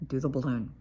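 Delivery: ordinary talk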